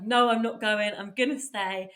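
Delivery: loud, emphatic retold refusal; firm refusal. Retelling her decision to stay back home, firm refusal. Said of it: "I was like".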